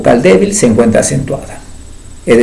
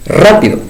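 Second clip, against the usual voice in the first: "rápido" with a strong, higher "rá"; stress third from last; 5 semitones higher than usual. Shown as a demonstration. Teacher giving an esdrújula example, "rápido" stressed on "rá". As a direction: punch "rá" at the start of "rápido". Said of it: "tercera sílaba".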